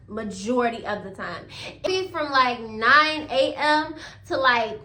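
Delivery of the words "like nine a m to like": normal talking voice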